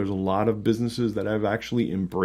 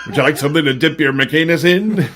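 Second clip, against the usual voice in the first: mock drive-thru cashier, laughing; fast food cashier; 5 semitones higher, 9 dB louder than usual. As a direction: play a cheery fast food server. Fast food clerk joke.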